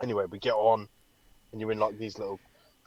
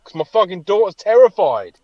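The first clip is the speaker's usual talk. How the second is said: defensive reply; 7 semitones higher, 9 dB louder than usual. Retold or imitated. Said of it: "I'm like".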